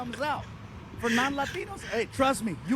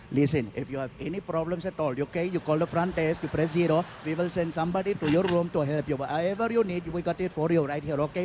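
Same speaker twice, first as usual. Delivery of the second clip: put-on Indian accent; 6 semitones lower than usual. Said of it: "He's like".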